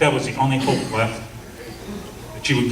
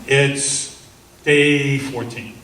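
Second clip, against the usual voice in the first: ordinary talk, nothing marked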